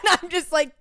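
bursts of high laughter; cracking up while telling it; laughing. Real, not acted. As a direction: laugh through the words. Laughing while retelling the exit.

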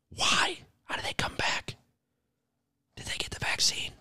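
hushed, unvoiced whisper; whispered questions. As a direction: whisper it as a secret aside. Whispered questions about the cicadas.

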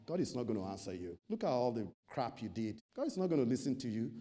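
low, soft, fast nagging thought; doubting inner voice. Retold or imitated. The tempting thought in Elijah's mind, low quick murmur.

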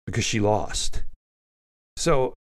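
usual speaking voice

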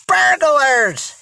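high, urgent aunt's voice; hissed alarm. A narrator's reading. Aunt warning of burglars in the house, hissing. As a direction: hiss it out in high-pitched alarm. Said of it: "hissed their aunt".

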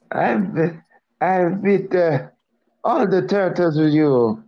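high cartoon voice, halting; high-pitched mock bragging; Mario talk. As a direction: boast as a squeaky plumber, groping for words. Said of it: "you gotta do the Mario talk".

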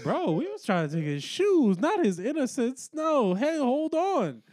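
rising, high mock-appalled objection; aghast protest. Accomplice tells the guy to hold on, appalled.

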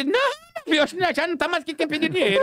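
high-pitched, hasty backing down; comic alarm. Acted. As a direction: act out a panicked retreat for laughs. Backing down on seeing the revolver. (susto fingido, recuando em tom agudo)

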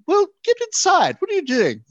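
high, loud mock scolding; callous. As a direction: shout it at her, exasperated and unsympathetic. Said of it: "a little callous".

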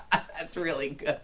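words through laughter; laughing. Real, not acted. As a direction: still laughing while speaking.

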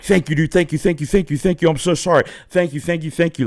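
high-pitched, repetitive stream of gratitude; retold over-grateful babble, apologetic; gushing thanks.

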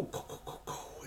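whispered, trailing "go, go"; whispered shooing. Acted. Jesus waving the Judeans away, in a whisper. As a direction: shoo them off in a whisper.

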